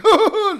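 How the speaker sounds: sudden loud high cackle; burst of laughter. Real, not acted. Streamer mocking a troll in chat, loud cackling laugh. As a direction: explode into one sharp laugh.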